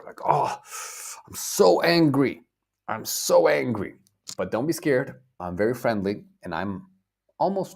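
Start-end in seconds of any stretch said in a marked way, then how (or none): 0.2-4.0 loud, high mock-angry outburst; acted anger. Teacher shows students what very angry means, acting angry. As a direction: demonstrate anger, raised voice leaning on "so". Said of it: "when you are very angry".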